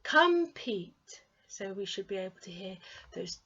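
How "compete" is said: "Compete" higher and louder, two syllables clear; clear model word. Shown as a demonstration. Teacher presenting a new word, clearly. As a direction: present "Compete" with a firm falling finish. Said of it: "And what about this one?".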